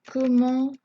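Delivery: loud, held, word-by-word dictation; dictating while typing. Real, not acted. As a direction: spell it out aloud as you type.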